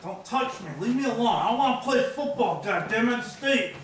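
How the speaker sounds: furious high shout, leave me alone; belligerent drunk. Acted. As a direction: yell it at full volume, fed up.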